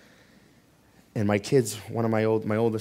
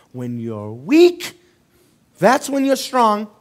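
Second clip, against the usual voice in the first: preaching build; 6 semitones higher, 10 dB louder, slower than usual. Real, not acted. Preacher quotes the Bible, building.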